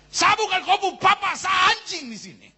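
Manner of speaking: put-on high, loud drunken yell; angry shout. Drunk father yelling angrily at his child. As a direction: bellow it at the kid. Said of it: "Papa marah sekali".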